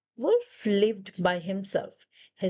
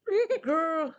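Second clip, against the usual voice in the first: higher playful mock growl; dog growling; 6 semitones higher than usual. Dog tugging the other end of the shoe, higher mock growl. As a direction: growl back like a scrappy little dog. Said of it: "said dog".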